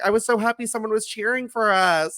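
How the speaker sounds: high-pitched imitated delight; retold happy gushing. Stacy thanking him for cheering, imitated high delight. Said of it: "She's like".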